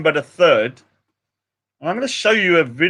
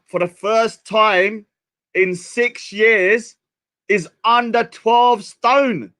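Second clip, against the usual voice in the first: slow, drawn-out proud announcement, rising higher; drawn-out triumphant announcement; slow dramatic reveal; 4 semitones higher, much slower than usual. Real, not acted. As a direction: spell it out slowly for effect.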